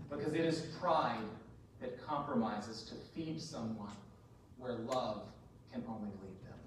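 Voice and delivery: low, hushed, spaced-out phrases; solemn plea. Real, not acted. Preacher warning helpers of burnout, solemn and quiet.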